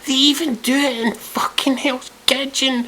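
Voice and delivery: very high, drawn-out disbelief; exasperated squeal. Real, not acted. Repeating himself about reality shows, squealing in disbelief. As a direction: push it right up into a squeal of outrage.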